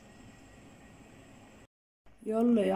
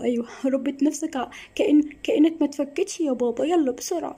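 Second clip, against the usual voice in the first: rising higher, rushed urging; urgent hurry; 5 semitones higher than usual. Acted. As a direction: hurry him before the kidnapper returns. Urging father before kidnapper returns.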